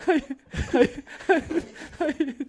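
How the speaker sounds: high, laughing despair. Real, not acted. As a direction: laugh it out in mock horror.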